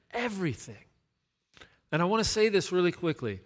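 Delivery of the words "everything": repeated softly. Shown as a demonstration. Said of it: "I wanna say that word one more time".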